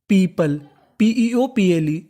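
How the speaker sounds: usual speaking voice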